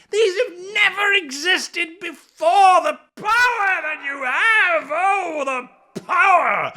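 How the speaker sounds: high, loud, teary mock rapture; hammed-up awe. GM hamming up awe at the world's power, teary and loud. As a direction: overcome by the power of creation, hammed up.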